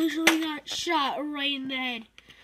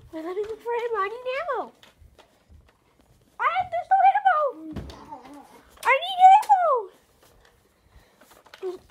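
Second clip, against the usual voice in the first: rising frantic plea for ammo; desperate, pitch climbing higher; panicked whine; 8 semitones higher, 8 dB louder than usual. Real, not acted.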